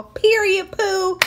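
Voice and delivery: high, loud sassy exclamation; excited. Real, not acted. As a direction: punch it out with glee. Excited sign-off catchphrase.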